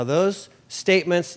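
ordinary talk

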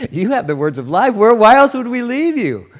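Peter pleading. Retold or imitated. Peter's bewildered reply.

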